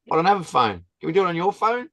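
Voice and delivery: high-pitched imitation of an eager old lady; the lady asks to use his phone, pitched high and eager; eager request. Imitating a giddy old lady.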